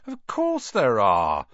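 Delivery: put-on slow, assured boy's voice; knowing Ron. A narrator's reading. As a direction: state it slowly as obvious.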